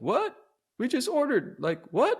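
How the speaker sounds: high-pitched, incredulous imitation of a friend. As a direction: squeal in mock disbelief at being left. Friend objects to him leaving.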